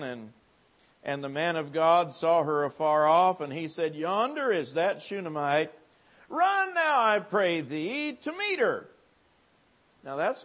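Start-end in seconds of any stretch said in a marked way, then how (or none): 1.0-8.9 preaching at full force. Acted. Preacher booming the scripture to the revival crowd. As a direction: preach it out to the congregation.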